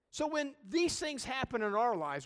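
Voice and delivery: normal talking voice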